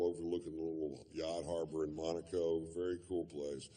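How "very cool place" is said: usual speaking voice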